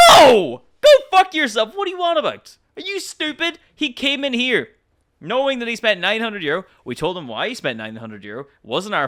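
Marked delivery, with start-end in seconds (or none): 0.1-6.0 loud, much higher yelling; furious shout. Acted. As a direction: blast him with rage. Furious outburst at customer.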